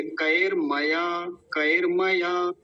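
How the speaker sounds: "कएर मया" slow, r closing before ma; half r. Shown as a demonstration. Teacher shows how it is said, slow half r. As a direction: sound out "कएर मया" as ka-er ma-yaa. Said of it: "आधा है, ऐसे".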